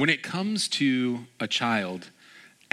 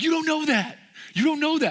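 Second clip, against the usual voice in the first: put-on high, louder wail of a heartbroken kid; imitating a devastated child, pitched high; upset crying boy; 6 dB louder than usual. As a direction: play the boy who just lost, on the verge of tears. Boy protests to his father.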